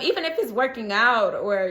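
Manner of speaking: usual speaking voice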